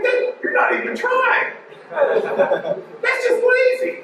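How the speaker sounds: squeaky high incredulous voice, trailing into a laugh; mock the lazy scammer in a high pitch; high, slow, laughing exasperation; exasperated high mock. Real, not acted. Mocking a lazy scam email.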